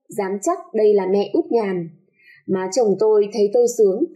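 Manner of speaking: usual speaking voice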